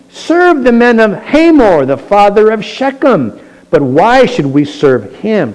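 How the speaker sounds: loud, high rabble-rousing oratory; defiant challenge. Acted. Gaal cursing Abimelech at a drunken party, loud defiant challenge. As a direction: throw the questions out loudly to a crowd.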